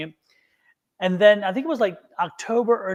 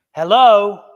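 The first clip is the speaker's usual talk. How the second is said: much louder second try; irritated phone greeting; 10 dB louder than usual. Retold or imitated. Nobody answers while the call connects, irritated. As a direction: call into a silent line, impatiently loud. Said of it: "I'm getting irritated with spirit".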